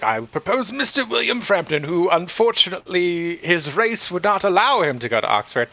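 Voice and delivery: mock the chairman's smug proposal; pompous chairman. Retelling the meeting to her cohost, light stuffy chairman voice.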